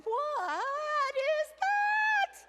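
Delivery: high, loud, very slow stretched question; hammed-up shocked what is that; shocked disbelief. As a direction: drag it out in stunned shock. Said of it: "I said".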